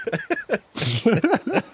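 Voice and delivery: sudden high loud laugh; high laughing burst. Real, not acted. Laughs at the joke.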